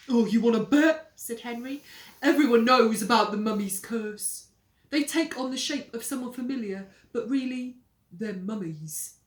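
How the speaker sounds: loud ominous telling; spooky sales pitch. Acted. Henry scaring Peter, loud ominous. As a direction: spook him.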